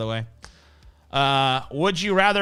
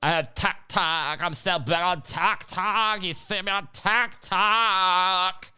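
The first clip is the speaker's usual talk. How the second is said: mock smug TikTok woman; 4 semitones higher than usual. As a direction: parody her bragging about followers. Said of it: "she's like".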